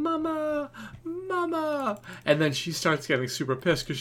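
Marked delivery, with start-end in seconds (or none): 0.0-2.0 put-on high child's voice calling for mama; high-pitched kid crying out mama. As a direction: call out like a little boy wanting his mom. Son calling for mom.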